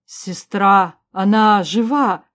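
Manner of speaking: slow, loud, astonished; stunned brother, drawn out and emphatic; gasped amazement. A narrator's reading. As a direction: exclaim it slowly, as if stunned by the news. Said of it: "ахнул Дроссарин".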